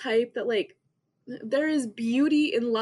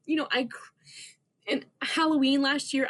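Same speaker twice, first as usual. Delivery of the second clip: ordinary talk, nothing marked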